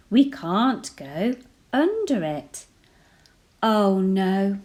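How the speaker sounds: plain reading voice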